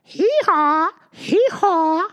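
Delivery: high, loud braying; donkey bray. Acted. Missus Donkey greets baby mouse, loud bray. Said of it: "said Missus Donkey".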